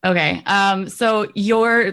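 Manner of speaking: ordinary talk, nothing marked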